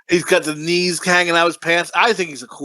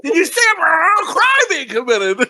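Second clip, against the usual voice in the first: very high, hammed-up shouted movie quote; blurred-out movie swearing, exaggerated; 9 semitones higher than usual. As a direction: shriek the quote, garbling the swearing even more. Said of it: "it keeps blurring it out".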